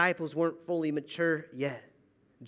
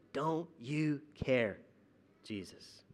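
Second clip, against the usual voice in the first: hushed plea; 3 semitones lower, 6 dB quieter, slower than usual. Retold or imitated. Quoting the doubting disciples.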